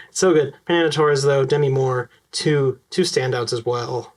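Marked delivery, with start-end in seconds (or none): none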